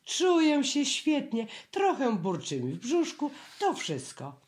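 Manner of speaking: bouncy bear waving off worry; high bear voice. A narrator's reading. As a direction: insist you feel fine. Pooh voiced by narrator.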